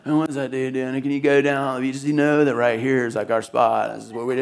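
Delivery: low, quiet mock grumble; crusty local grumbling. Pastor imitating crusty local surfers grumbling, low and quiet. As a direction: rumble it like a territorial old surfer. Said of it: "rumblings of all the crusty local guys".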